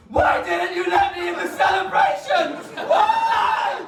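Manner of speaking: put-on high, shouted child's wail; mock child tantrum. Best man mimicking young Matt, a high mock shout. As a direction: yell it like a sulking kid left out. Child Matt's outburst acted out.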